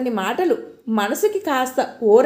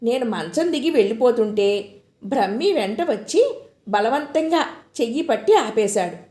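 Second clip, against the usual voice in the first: ordinary delivery, nothing marked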